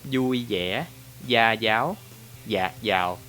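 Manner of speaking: Saigon accent, "Vui vẻ" as "yui ye", "gia giáo" as "ya yao"; Southern Vietnamese accent. Shown as a demonstration. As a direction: demonstrate Southern Vietnamese, turning v and gi into y. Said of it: "they're gonna pronounce like this".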